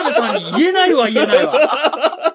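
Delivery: laughing hard through the words; can't stop laughing at yourself. Real, not acted. Laughing at his own blunder.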